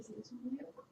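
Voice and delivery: soft whispered retort. Retold or imitated. Father whispering the joke to his crying son. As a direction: breathe it out as a secret jab. Father's teasing reply in retold story.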